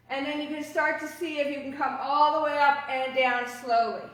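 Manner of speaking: usual speaking voice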